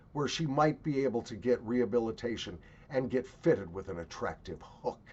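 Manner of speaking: normal talking voice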